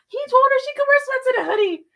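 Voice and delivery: furious on her friend's behalf; outraged. Real, not acted. Outraged retelling of the date.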